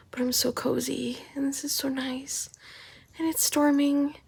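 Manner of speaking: soft contented whisper; cozy whisper. Real, not acted. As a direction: breathe it out like you never want to leave bed. Cozy in bed, storm outside.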